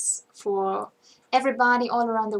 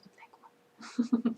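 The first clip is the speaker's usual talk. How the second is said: whispered quick fix; whispered correction; 3 dB quieter, slower than usual. Real, not acted.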